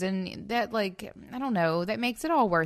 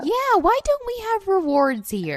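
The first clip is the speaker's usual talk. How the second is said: excited; 13 semitones higher, 8 dB louder than usual. Real, not acted. Excited joking suggestion.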